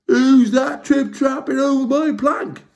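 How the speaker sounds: high, loud mocking taunt; jeering. Hank Chief mocking the Trawl on the plank, jeering. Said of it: "jeered Hank Chief".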